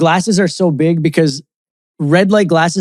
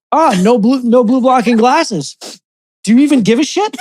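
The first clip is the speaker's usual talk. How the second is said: grumpy angry yell; 6 semitones higher than usual. Retold or imitated. Partner mimics her angry wake-up.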